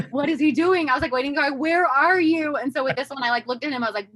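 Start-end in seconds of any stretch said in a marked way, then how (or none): 0.1-2.5 higher, louder impatient yelling; where on earth is he; mock frantic calling out; exasperated call. Husband making coffee, impatient wait.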